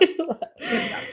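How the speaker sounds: high, drawn-out laughing repeat. Real, not acted. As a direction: stretch it out through laughter. Repeating the phrase while both laugh.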